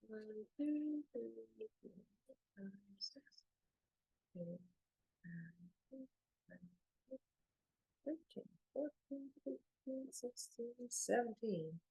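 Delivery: very soft, hushed counting; counting under breath. Real, not acted. Reader counting to herself to find the message, under her breath. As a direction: count almost in a whisper, pausing between numbers.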